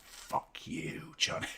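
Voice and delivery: a mock hushed curse from the wife; wife's whispered morning insult; whispered quote. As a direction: whisper it, unvoiced, imitating the missus. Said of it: "She's like".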